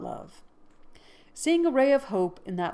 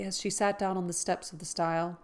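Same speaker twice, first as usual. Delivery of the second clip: ordinary delivery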